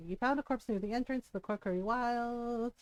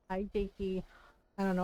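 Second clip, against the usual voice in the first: usual speaking voice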